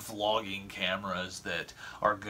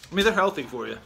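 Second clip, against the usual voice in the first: loud, higher fake-earnest claim; playful mock sincerity; 6 semitones higher, 7 dB louder, faster than usual. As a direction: sell the lie with a grin. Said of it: "That's a lie".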